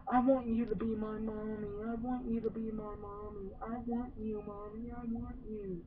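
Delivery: high, hushed creepy-child imitation; creepy toy voice. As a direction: mimic the creepy doll begging for a mommy. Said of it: "So he's like".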